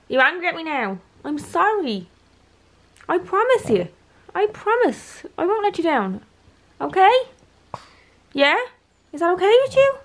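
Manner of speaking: high, cooing voice for a dog; pet-talk pleading. Real, not acted. Owner begs the dog's forgiveness, cooing and pleading. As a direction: coax an offended pet in a very high voice.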